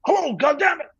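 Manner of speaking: high, loud American yelling into a phone. Retold or imitated. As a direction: shout down a bad line in an American accent. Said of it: "I heard this American voice going".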